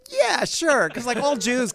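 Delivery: very high, loud agreement; eager bluff. Retold or imitated. Acted eager bluff to the clients.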